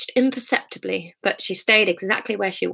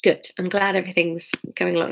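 usual speaking voice

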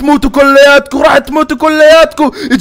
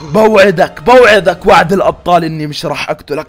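low, softer sly reassurance; false coaxing; 6 semitones lower, 4 dB quieter than usual. Acted. Fire creeper luring the snow creeper out with fake promises. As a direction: lure him out sweetly.

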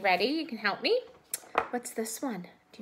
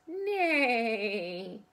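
a long neigh; whinny like a horse. Shown as a demonstration. Teacher shows children the horse sound, neighing. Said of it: "Put your fingers like this".

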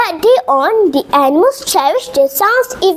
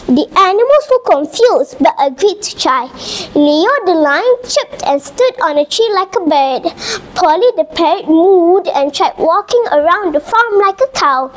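plain reading voice

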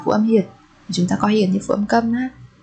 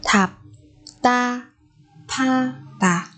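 "Thạp ta pha tà" slowly, with long gaps; syllable by syllable; 3 semitones higher, much slower than usual. Shown as a demonstration. Teacher explaining a sound change, slow separated syllables.

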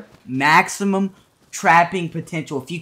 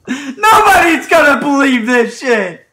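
laughing shout; 7 semitones higher, 7 dB louder than usual. Real, not acted. Laughing shout over build.

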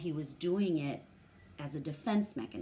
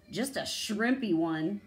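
scoffing; 3 semitones higher, 6 dB louder than usual. Acted. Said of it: "dog scoffed".

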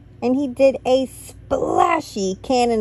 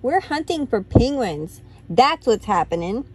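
plain reading voice

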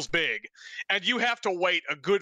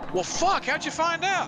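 high-pitched mock dismay; retold dismay. Asking his buddy how he found out, high mock shock. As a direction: pitch it way up like you can't believe it. Retelling reaction to friend's news.

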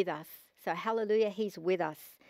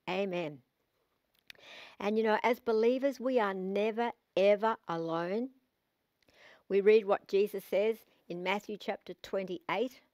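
ordinary talk, nothing marked

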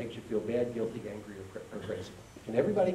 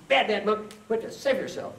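loud, high mock scolding; hammed-up indignant outburst; angry scolding monk; 9 semitones higher, 6 dB louder than usual. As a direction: tell him off like an outraged monk. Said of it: "is angry".

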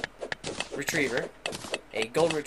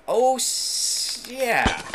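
high, loud happy yeah; excited cheer; 10 semitones higher, 5 dB louder, much slower than usual. Real, not acted. Gamer finding more treasure, high excited cheer. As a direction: cheer at the discovery.

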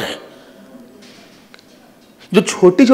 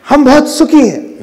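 high, emphatic mock boast; self-satisfied declaration; 8 semitones higher, 3 dB louder than usual. Preacher voicing the wrong thought of happiness, emphatically self-satisfied. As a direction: proclaim it high, leaning on "सुखी". Acts self-centred happy man, then corrects.

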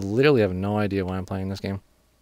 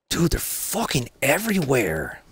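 higher, drawn-out exasperated whine; 4 semitones higher, slower than usual. Real, not acted. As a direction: stretch it out in real exasperation. Frustrated gamer, enemies all around.

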